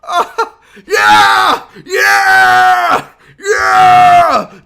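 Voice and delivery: screaming high and loud with joy; ecstatic cheering. Real, not acted. Streamer cheers a dancing player in the game, screaming with joy. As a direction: yell it out like your team just scored.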